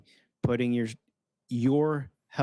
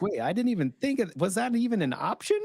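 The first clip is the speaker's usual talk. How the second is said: higher-pitched, mock astonished realisation; acted amazement; 8 semitones higher than usual. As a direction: play the sudden discovery of an option.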